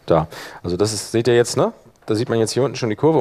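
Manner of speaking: ordinary delivery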